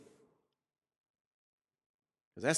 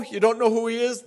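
higher incredulous question; mock surprise; 5 semitones higher, 4 dB louder, faster than usual. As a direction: ask in feigned amazement. Pastor questioning the weeper.

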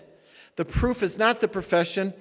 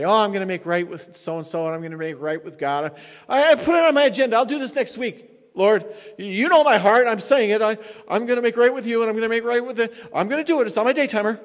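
higher, louder, fast mock of a careless believer; mocking excuse-maker; 6 semitones higher, 8 dB louder, faster than usual. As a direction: ham up the promising procrastinator. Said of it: "There are believers who say".

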